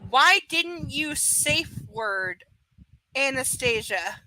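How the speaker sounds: very slow, word-by-word "Why didn't you safe word"; deliberate, stilted reading; mocking as written. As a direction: read "Why didn't you safe word" haltingly, spotlighting the bad wording. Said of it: "That is how it's written".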